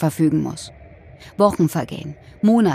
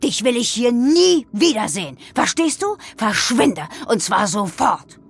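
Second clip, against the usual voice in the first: higher-pitched, furious dismissal; father's angry roar; 4 semitones higher than usual. A narrator's reading. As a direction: order someone away in rising outrage. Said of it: "brüllte mein Vater weiter".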